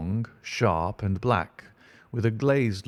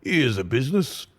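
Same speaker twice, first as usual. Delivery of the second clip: husky, muffled cry, voiced higher; 4 semitones higher than usual. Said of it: "he cried in a muffled, husky voice".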